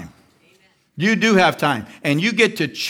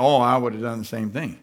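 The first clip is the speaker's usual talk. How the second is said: sheepish; 6 semitones lower, 5 dB quieter than usual. Retold or imitated. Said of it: "now all of a sudden you're like".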